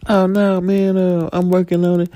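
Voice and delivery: stalling debtor. Acted.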